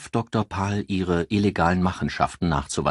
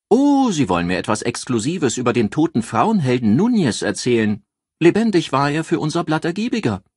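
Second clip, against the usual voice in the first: lively newspaper editor; 9 semitones higher, 6 dB louder than usual. A narrator's reading. Eccentric editor Joyce greets the detective on the phone, high and gushing. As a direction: play her as a gushing, theatrical editor thrilled to hear from him.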